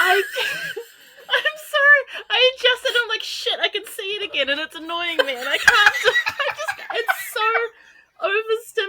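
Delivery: high-pitched giggly apology; laughing. Real, not acted. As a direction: laugh and squeal it out.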